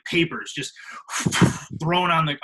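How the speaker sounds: ordinary talk, nothing marked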